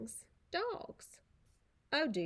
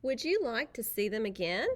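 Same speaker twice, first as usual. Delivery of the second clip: usual speaking voice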